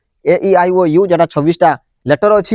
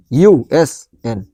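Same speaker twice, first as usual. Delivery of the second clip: slow letter spelling; 6 semitones lower, slower than usual. Shown as a demonstration. Teacher to class, slowly spelling a wrong letter order. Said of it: "ମୁଁ ଯଦି ଏମିତି ଲେଖିଥାନ୍ତି".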